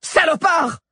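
high, loud insult; furious heroine screaming at the doctor; shouted. A narrator's reading. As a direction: hurl the insult at him. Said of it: "cria Alix".

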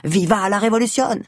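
rebel battle cry, yelled into the night; shouting. A narrator's reading. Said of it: "hurle dans la nuit".

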